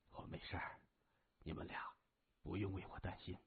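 barely audible, low and weak; faint voice. A narrator's reading. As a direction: reassure them with hardly any strength left. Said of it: "微声说". (微弱的声音)

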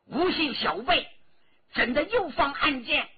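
high, loud, harsh rebuke; stern shout. A narrator's reading. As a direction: berate the sneaky archer fiercely. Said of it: "他厉声喝道". (厉声呵斥，又高又响)

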